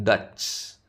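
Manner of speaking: "ഡച്ച്" with a long, clearly released final ch; final "ch" sound. Shown as a demonstration. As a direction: draw out the last ch of "ഡച്ച്". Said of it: "last ച് sound വരുന്നത്".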